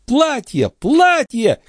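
high-pitched, delighted exclamations; excited squeal of joy; joyful cries. A narrator's reading. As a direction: cry out in glee, clapping along. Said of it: "кричала Шон, хлопая в ладоши".

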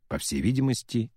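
plain reading voice

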